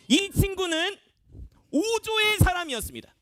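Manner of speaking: high, projected announcement; dramatic reveal. Acted. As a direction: announce the culprit's team to the room. Revealing the team in the game. (높고 힘차게 발표하듯)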